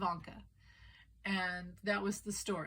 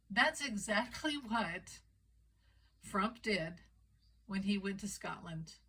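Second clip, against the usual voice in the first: ordinary delivery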